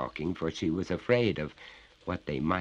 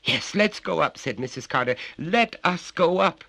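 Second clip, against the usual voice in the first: higher, louder urgency; urgent mother; 12 semitones higher, 7 dB louder than usual. Acted. Mrs Carter urges them upstairs, higher and urgent. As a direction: hurry them on.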